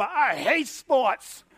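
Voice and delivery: drawn-out, dragging "I hate sports"; hammed-up exhausted parent; mock weary complaint. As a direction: let it sag out slowly, fed up.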